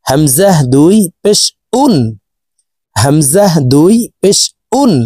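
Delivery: উন started with a hard catch after a break; stop, then attack উন firmly from the throat; hard hamza. Shown as a demonstration. Teacher drilling hamza, hard glottal start on উন. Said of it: "হামজার উচ্চারণ শক্তভাবে পড়তে হবে".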